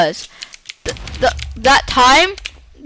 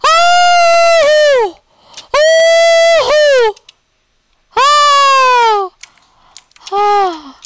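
high, loud alarmed cries; frightened shouts; 6 dB louder, much slower than usual. Real, not acted. Gamer shouts in fright at a fall. Falling danger in game.

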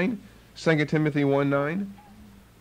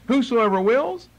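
scoffing question; 6 semitones higher than usual. Acted. Scoffing at the false teaching.

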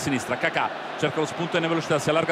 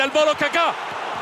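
excited call; 8 semitones higher, slower than usual. Real, not acted. Volley shot call.

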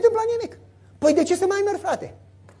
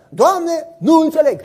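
protesting Joshua; 5 semitones higher, 7 dB louder, slower than usual. Acted.

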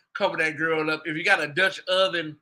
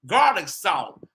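usual speaking voice

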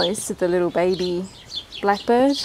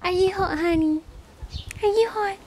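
high pet voice; 10 semitones higher than usual. Real, not acted. Asking her duck if it is hot, high coo.

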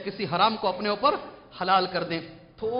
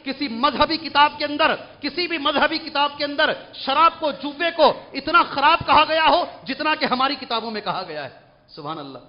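ordinary talk, nothing marked

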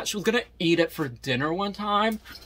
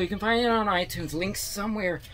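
usual speaking voice

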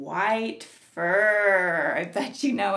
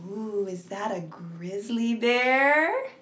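ordinary talk

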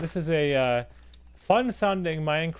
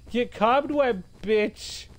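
high, loud gloating jeer; raised-voice trash talk; triumphant taunt; 7 semitones higher, 3 dB louder, much slower than usual. Real, not acted. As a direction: crow it at a beaten enemy. Taunting a spider in game.

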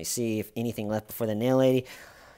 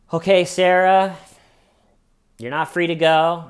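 firm announcement; 7 semitones higher, 9 dB louder than usual. Retold or imitated. Detective announces her detention.

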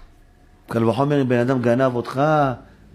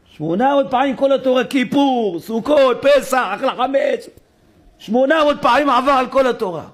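rising preacher's exclamation; 11 semitones higher, 3 dB louder than usual. Acted. Preacher exclaims eight hundred times.

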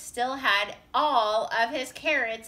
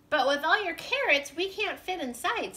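higher, mildly puzzled voice; a little bewildered; 7 semitones higher than usual. A narrator's reading. Beaver facing all Rabbit's carrots, mildly bewildered. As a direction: raise the pitch in gentle bewilderment. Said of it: "a little bit bewildered".

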